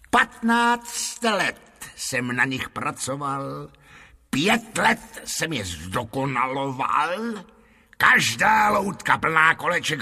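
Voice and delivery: slow, drawn-out fairground patter, rising on the lists; boastful showman. Acted. Lotrando boasts of his puppets to the lord, boastful showman. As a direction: sell the marvels with slow relish.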